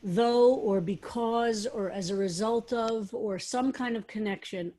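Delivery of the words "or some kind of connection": ordinary delivery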